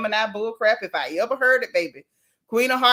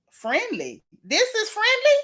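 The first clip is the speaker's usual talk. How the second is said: high-pitched disbelieving question; rising, incredulous echo; 11 semitones higher than usual. Real, not acted. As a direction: question it in sheer disbelief, voice shooting up. Incredulous reaction to "friendly fade.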